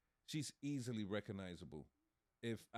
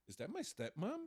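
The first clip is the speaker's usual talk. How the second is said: put-on much higher, incredulous teen; mock shocked son; 10 semitones higher than usual. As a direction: squeak it out like a stunned kid. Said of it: "and say".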